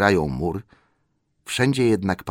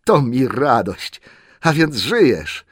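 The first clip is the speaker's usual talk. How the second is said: slow, loud, high-pitched joy; delighted exclamation; 7 semitones higher, 7 dB louder, slower than usual. A narrator's reading. Wizard finds the hobbit alive, delighted and drawn out. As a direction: draw it out with glad relief. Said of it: "czarodziej ucieszył się ogromnie".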